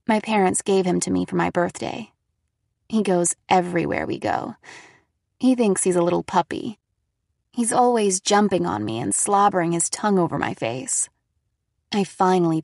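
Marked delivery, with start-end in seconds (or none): none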